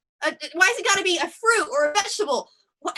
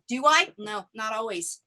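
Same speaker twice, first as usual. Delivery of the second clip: ordinary talk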